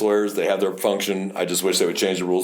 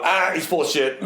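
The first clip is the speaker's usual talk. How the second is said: higher, dismissive scoff; mock outburst; 7 semitones higher than usual. Officer on the stand scoffing at the lawyer. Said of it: "You can't go".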